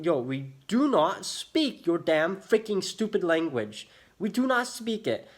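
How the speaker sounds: slow, hammered-out retort with heavy stress; exasperated telling-off. Retold or imitated. Frustrated customer telling staff they don't speak Polish, slow and exasperated. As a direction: spell it out slowly, word by emphatic word.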